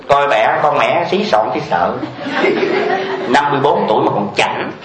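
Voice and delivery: mock scornful jab; sneering gossip. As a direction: belittle her with a chuckle. Voices a woman sneering at another.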